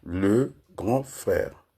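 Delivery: very slow, each syllable set apart. Shown as a demonstration. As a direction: spell it out slowly, one syllable at a time. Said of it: "Je vais par syllabe".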